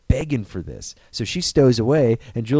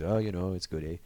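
low, soft Canadian small talk; Canadian greeting; 5 semitones lower, 9 dB quieter than usual. Retold or imitated. John Candy chatting at the border, soft, low Canadian greeting. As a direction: toss it off quietly with a Canadian lilt. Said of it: "like, Canadian greetings".

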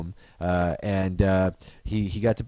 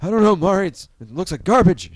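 higher, louder dismissive character voice; acted cartoon reply; 7 semitones higher, 6 dB louder than usual. Cartoon scientist dismissing the sight to his sidekick, raised mock voice. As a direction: wave it off, pitched up.

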